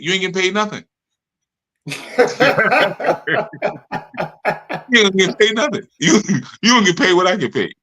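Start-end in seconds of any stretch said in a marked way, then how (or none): 1.9-4.9 a long helpless real laugh. Real, not acted. Laughing at his own student impression, a real long laugh.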